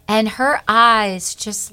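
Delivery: ordinary delivery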